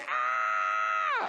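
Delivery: long, held shriek; drawn-out "aah" of horror; mock horrified scream. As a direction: imitate a terrified girl screaming. Girl's horrified reaction acted out.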